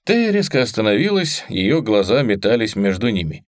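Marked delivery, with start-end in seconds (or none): none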